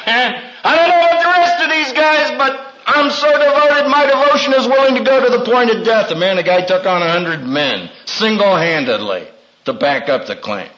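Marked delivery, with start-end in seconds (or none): 0.1-6.1 boastful Peter. Retold or imitated.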